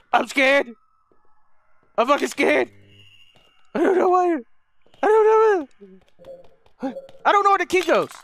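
anxious high complaining; scared. Real, not acted. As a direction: voice lifted by nerves. Admits fear mid-game.

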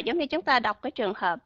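ordinary delivery

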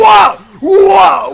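high, loud cries of alarm; startled shouts. Real, not acted. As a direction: shout in sudden shock. Startled as he dies.

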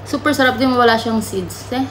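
chewing while speaking; mid-bite casual speech; talking with food in mouth. Real, not acted. Seen 0: bites durian at -1.0, then talks holding piece, likely chewing.

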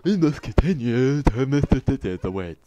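loud, goofy dimwit voice; really stupid voice. Acted. Player voicing a game character, really stupid voice. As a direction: read it loudly in a silly, stupid voice. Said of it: "like, a really stupid voice".

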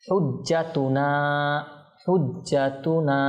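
হুজ্জাতুনা with the final "naa" held long; drawn-out madd. Shown as a demonstration. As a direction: stretch the end of হুজ্জাতুনা. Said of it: "এটা টানবো যেহেতু এখানে মত রয়েছে".

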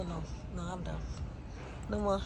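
normal talking voice

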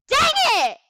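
very high loud outburst; angry shout. Real, not acted. Gamer annoyed, high loud outburst.

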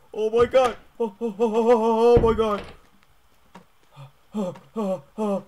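high, loud terrified screams; panicked screaming. Real, not acted. Player caught by the homeowner, terrified loud screaming. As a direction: shriek in real fright.

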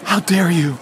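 clipped voice unlike his own, mock outrage; Greta Thunberg. Blogger mocks Greta over police cars, mock outraged rebuke. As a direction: throw it back as a mock activist rebuke. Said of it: "Greta Thunberg says".

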